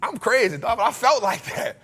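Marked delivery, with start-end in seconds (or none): none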